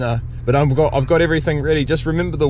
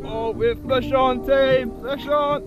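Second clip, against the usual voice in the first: thrilled, higher-pitched cry; excited; 7 semitones higher, 4 dB quieter, slower than usual. Real, not acted. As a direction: hype the crew up as the fish bites. A fish is hooked.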